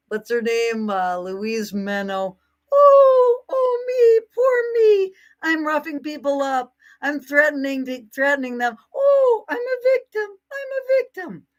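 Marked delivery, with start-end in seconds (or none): 2.7-11.5 much higher, wailing fake sob; hammed-up sarcastic boo-hoo whine; mock crying victim. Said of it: "Piss off with your cry bullying".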